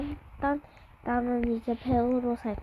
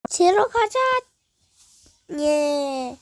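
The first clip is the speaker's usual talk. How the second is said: excited cheer; 4 dB louder, slower than usual. Real, not acted. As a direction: cheer it out eagerly. Heading to swim, cheering.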